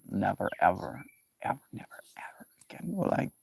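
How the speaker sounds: never ever trailing off into a breathy whisper; dramatic vow whispered away; fading to a whisper. Retold or imitated. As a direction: let the vow dwindle into a whisper. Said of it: "where you're like".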